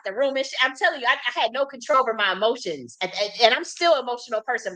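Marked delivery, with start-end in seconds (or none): none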